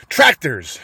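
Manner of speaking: sudden alarmed shout; Tractors! shouted out in alarm. A narrator's reading. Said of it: "shouted Mister Fox".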